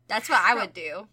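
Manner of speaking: ordinary delivery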